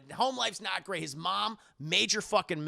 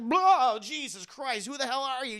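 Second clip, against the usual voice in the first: startled yelp; 7 semitones higher, 6 dB louder than usual. Retold or imitated. Startled Yusuke.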